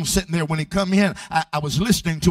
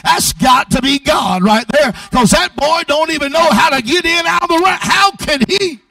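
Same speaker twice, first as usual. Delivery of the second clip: loud, driving sermon climbing to a high shout; full-force preaching; 5 semitones higher, 8 dB louder than usual. Real, not acted. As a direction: preach it hard, building to a high-pitched peak.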